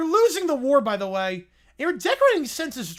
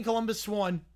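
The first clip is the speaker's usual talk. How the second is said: normal talking voice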